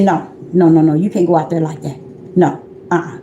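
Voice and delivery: low, quick forbidding voice; stern refusal. Retold or imitated. He won't let her go out like that, low firm refusal. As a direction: lay down the law, pitched low.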